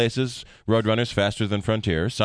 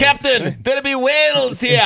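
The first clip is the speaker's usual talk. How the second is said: much higher, louder mock Star Trek crewman voice; movie character quote; 14 semitones higher, 6 dB louder than usual. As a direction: ham up the starship officer line. Quoting a Star Trek line.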